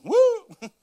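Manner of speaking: sudden high loud siren whoop. Retold or imitated. Pastor imitating the police siren when pulled over, loud high whoop.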